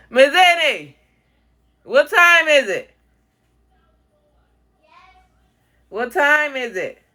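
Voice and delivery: loud high slow call. Real, not acted. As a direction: shout the question slowly to someone far off. Calling out to someone.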